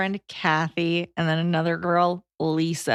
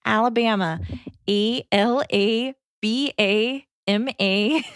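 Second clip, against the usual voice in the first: put-on slow Minnesota accent, spelling it out; deliberate Minnesotan spelling-bee delivery; 3 semitones higher, slower than usual. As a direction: imitate a Minnesotan pageant contestant, drawn out. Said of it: "In that accent?".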